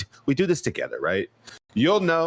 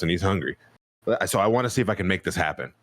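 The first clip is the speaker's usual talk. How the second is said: usual speaking voice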